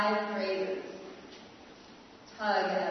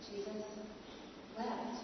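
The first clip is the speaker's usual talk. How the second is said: hushed; 12 dB quieter than usual. Acted. Slow hushed refrain.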